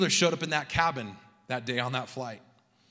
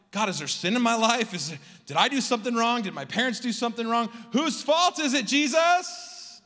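questions climbing higher, ending in a loud cry; anguished questioning; 7 semitones higher, 3 dB louder than usual. Acted. Preacher voicing a doubting heart to God, anguished questioning. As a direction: build from doubt to a high, exasperated demand. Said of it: "your heart starts to wonder".

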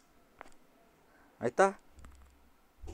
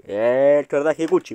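"tordaje Gucci" stretched slowly and louder like an announcer; announcer drawl; 5 dB louder, much slower than usual. Shown as a demonstration. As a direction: present the brand with a slow, grand drawl. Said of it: "tenemos el tordaje Gucci".

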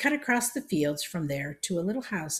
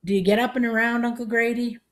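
high, loud question; raised grandmother voice, pitched up; speaking up; 7 semitones higher, 7 dB louder than usual. A narrator's reading. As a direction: holler it for a deaf old man. Shouting to hard-of-hearing uncle.

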